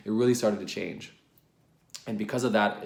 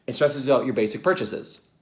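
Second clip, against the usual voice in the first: ordinary delivery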